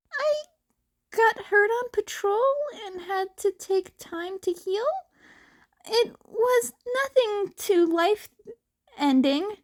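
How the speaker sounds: put-on a high, slightly tearful boyish voice brushing off an injury; young Izuku.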